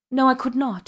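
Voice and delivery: high, loud protest; crying out. A narrator's reading. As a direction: cry it out in refusal. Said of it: "she cried".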